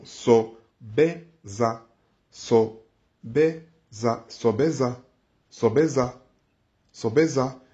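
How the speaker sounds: Sobeza drawn out very slowly with a rise at the end; slow model pronunciation. Shown as a demonstration. Teacher models the tones slowly for learners. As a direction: stretch out each syllable of Sobeza. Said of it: "les intonations sont super importantes".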